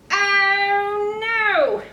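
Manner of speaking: high, loud hammed-up gasp; mock dismay. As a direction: play the shock big for kids. Button pops, dramatic dismay.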